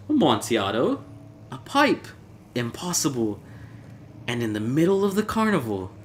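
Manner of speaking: put-on high, incredulous drunken reveller; a tipsy friend who cannot believe his ears; astonished Fortunato.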